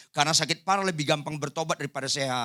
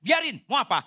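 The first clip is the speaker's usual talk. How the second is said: fierce; 6 semitones higher than usual. Retold or imitated.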